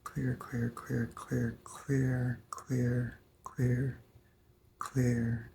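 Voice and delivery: soft chant dropping to a whisper; fading to a whisper. Acted. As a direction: let each clear fade into breath. Clearing mantra fading to whisper.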